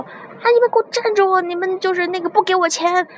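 put-on high, frantic yell after fleeing girls; crazed angry yelling. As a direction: shriek it like a furious driver giving chase. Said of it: "大叔就跟疯了一样啊". (模仿大叔发疯似的高声怒吼)

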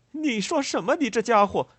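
very high, loud challenge; acted furious disbelief; outraged retort. A narrator's reading. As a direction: fire back in outrage, voice shooting up. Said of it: "布瑞可南回答道".

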